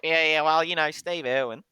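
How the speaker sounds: put-on Australian voice. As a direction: ham up your own Australian accent. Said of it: "I like how you had to make a voice".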